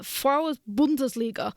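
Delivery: Frauen Bundesliga said in German; German accent. Pretend. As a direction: say it like a German speaker. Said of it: "Your German accent is better".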